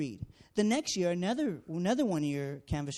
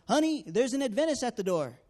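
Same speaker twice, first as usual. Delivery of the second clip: mock call into the next room, louder and higher; calling out to his wife in the back, half-yelled; imitating a husband's raised call; retold yell; 6 semitones higher, 6 dB louder than usual. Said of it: "And I hear him yell in".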